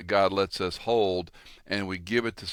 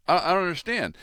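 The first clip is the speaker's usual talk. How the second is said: high, confused protest; a man who cannot grasp his time is up; bewildered plea; 10 semitones higher, 3 dB louder, faster than usual. Retold or imitated. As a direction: play the baffled rich man, pitched up. Confused man before angel.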